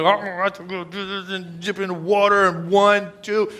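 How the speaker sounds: a lightly voiced sulky child, grudging; sulky little kid. Preacher imagining Naaman as a reluctant child dipping in water, sulky. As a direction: grumble it like a kid forced to do chores. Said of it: "like one of them little kids".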